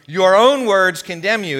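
loud stern rebuke; king's loud, stern condemnation. Acted. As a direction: scold him with the king's anger. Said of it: "it says the king roared".

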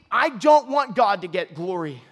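ordinary talk

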